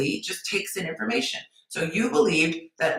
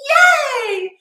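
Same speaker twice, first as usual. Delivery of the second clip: sarcastic shouted yay, much higher and louder; mock loud cheer; 9 dB louder than usual. Mocking a woman thrilled by scraps, loud sarcastic cheer. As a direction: cheer it like a tiny win is huge.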